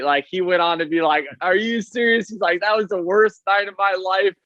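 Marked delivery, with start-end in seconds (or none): 1.4-4.3 high, incredulous complaint; mock-wounded disbelief; disbelieving. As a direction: play it up as an aggrieved manager. Roberts quoted in disbelief.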